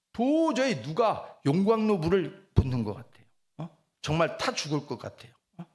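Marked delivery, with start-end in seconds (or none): none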